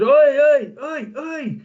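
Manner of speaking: high, hollered hail down a train carriage; loud first calls, then pulled back; imitating shouting. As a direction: mimic a stranger calling out for attention. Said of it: "shouting down the tube".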